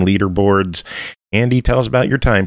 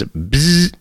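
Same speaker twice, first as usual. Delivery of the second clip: drawn-out electric buzz; game title said as a buzzing noise; 4 semitones higher than usual. Shown as a demonstration. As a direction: sound out a long zapping buzz. Sounding out the game's buzz title.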